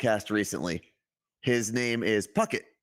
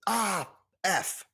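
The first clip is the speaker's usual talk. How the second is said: mock yell; 7 semitones higher than usual. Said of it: "she'll yell".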